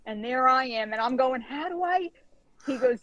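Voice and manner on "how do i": high-pitched, helpless retold question; mock-bewildered beginner; baffled question. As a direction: pitch it way up, at a loss. Baffled student asking instructor for help.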